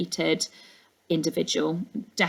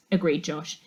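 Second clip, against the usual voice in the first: ordinary delivery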